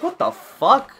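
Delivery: high, loud incredulous questioning; shocked disbelief. Retold or imitated. Friend questioning him about the picture, shocked disbelief. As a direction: demand an answer, acting astonished.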